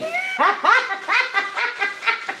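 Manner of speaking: high rising laugh. Real, not acted. Vlogger laughing after the joke, rising laugh. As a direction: let the laugh climb. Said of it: "[name], you laughing at me?".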